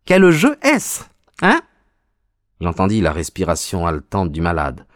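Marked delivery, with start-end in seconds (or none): 0.0-1.6 high-pitched, mocking villain; gloating visitor. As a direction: taunt the sick man in a high voice. (visiteur moqueur à la voix aiguë)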